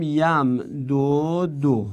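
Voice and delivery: یم دو دو slowly, each syllable apart; pronunciation model. Shown as a demonstration. Teacher modelling the unmerged verb, slow and syllabic. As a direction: sound out یم دو دو with both long u sounds.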